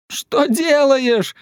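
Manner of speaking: high, drawn-out yell; child's cry of protest. A narrator's reading. Петя grabs his digger back from dad, crying out. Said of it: "закричал он".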